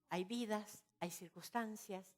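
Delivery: normal talking voice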